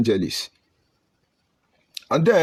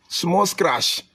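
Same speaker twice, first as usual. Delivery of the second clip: ordinary talk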